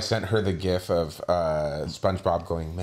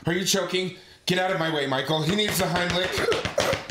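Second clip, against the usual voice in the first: mock alarm; 10 semitones higher than usual. Skit, friend seems to choke.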